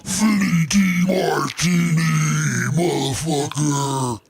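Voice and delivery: slow, loud growled shout in a put-on voice; booming put-on announcer shout; new voice for Vinny. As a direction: bellow the name like a wrestling announcer. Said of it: "Vinny, you ready for your new voice?".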